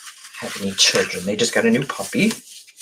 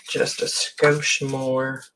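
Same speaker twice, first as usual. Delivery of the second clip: breath held while cutting carefully; holding breath; 3 dB quieter, much slower than usual. Real, not acted. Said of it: "I'm holding my breath".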